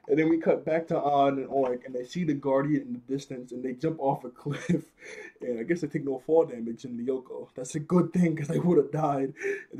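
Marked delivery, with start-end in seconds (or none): none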